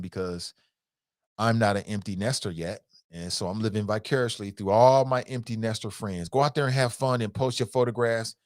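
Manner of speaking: ordinary delivery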